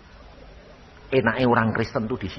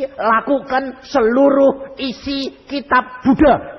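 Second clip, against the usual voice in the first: high, drawn-out insistence; slow emphatic instruction; 13 semitones higher, 4 dB louder, slower than usual. Retold or imitated. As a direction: spell it out slowly. Menegaskan dengan lambat. (menegaskan perlahan dan tinggi)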